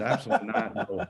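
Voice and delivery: high-pitched retold refusal through laughter; laughing refusal. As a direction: laugh off the very idea, voice jumping high. Retelling his refusal, with laughter.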